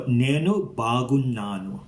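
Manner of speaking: "Nenu Bagunnanu" said very slowly, nn held long; slow demonstration. Shown as a demonstration. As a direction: spell out "Nenu Bagunnanu" syllable by syllable.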